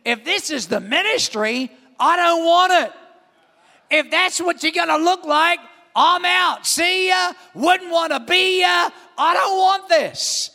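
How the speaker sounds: mock-defiant rant of a young pastor; exasperated inner shout. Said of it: "I remember thinking".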